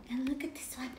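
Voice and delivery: fast unvoiced whisper; quick hushed aside. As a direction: share it like a secret. Reading book to child.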